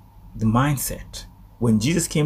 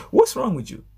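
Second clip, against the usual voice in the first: high-pitched mock indignation; indignant rebuke; 12 semitones higher than usual. Preacher voicing the Pharisees to the officers, indignant rebuke. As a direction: play someone scolding in disbelief.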